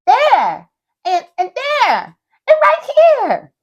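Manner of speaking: high, loud eager cries; excited pointing. Acted. Excited child pointing at lights.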